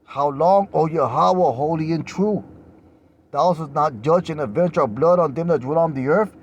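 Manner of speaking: crying out loud to God; loud pleading cry. Acted. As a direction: call it out like a plea for vengeance. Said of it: "Remember, they said it with a loud voice".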